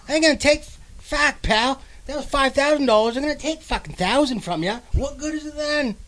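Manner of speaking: hammed-up bitter Mainer complaining about taxes; grumpy Mainer rant. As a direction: grouse like a sour local who hates good news. Grumbling Mainer mocking lotto winner.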